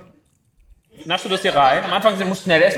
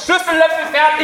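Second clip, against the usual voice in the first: loud protest; 10 semitones higher, 5 dB louder than usual. Real, not acted. Heated argument about game rules.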